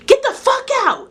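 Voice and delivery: very high-pitched, sharp outburst; re-enacted furious shout; loud demonstrated yell. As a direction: belt it out like you're kicking someone out. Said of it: "I did it so loud".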